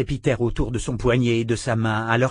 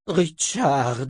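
croaked name; 4 semitones higher than usual. A narrator's reading. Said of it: "croassa la sœur".

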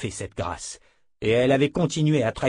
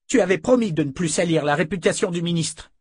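high, moderately raised angry accusation; cri de colère; 7 semitones higher, 3 dB louder than usual. A narrator's reading. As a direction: cry out the accusation, enraged. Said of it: "cria-t-il". (accusation criée avec colère)

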